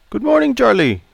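put-on polite Chinese-accented barber greeting. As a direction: voice Pei the barber, courteous. Barber Pei greets customer.

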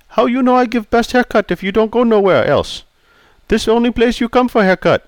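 put-on polite Chinese-accented barber greeting. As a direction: voice Pei the barber, courteous. Pei retorts.

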